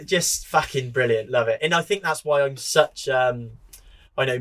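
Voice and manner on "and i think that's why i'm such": ordinary talk, nothing marked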